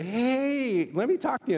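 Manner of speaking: mock-cheery hey; eager greeting. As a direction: pitch it up like a pushy friendly greeter. Cheerful approach acted out.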